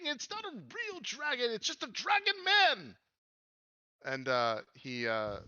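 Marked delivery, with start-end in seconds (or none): 0.0-3.0 very high, loud little character; high-pitched theatrical yelling for help; exasperated pleading. Acted. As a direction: holler upstairs in a squeaky, urgent voice. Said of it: "He says".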